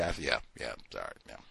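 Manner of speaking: suddenly low and soft apology; mock meek backing down. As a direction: shrink back, mumbling a cowed apology. Acts cowed reply to scolding.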